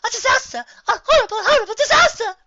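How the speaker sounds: high, loud hysterical cry; melodramatic panic. Acted. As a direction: ham up the disaster. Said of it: "concluded a third earth pony".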